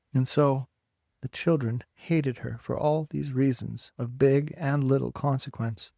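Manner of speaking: plain reading voice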